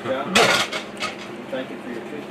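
normal talking voice

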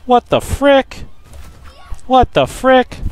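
slow, stretched high question; drawn-out baffled. Real, not acted. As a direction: draw out each word in disbelief. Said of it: "repeats it slower".

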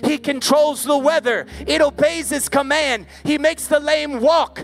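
full-force preaching. Acted. Preacher thundering to a night crowd, full force. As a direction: preach it to the back row with fire.

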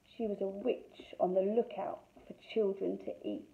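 ordinary delivery

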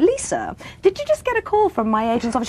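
high, airy mock-innocent enquiry; feigned innocence. Actress phoning the producer she pranked, feigning innocence. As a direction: ask it as if knowing nothing.

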